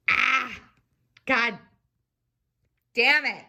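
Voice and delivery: genuinely pissed off, cursing over the spill; real angry cursing. Real, not acted. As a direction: swear at the spilled mess, really annoyed. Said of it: "I'm pissed".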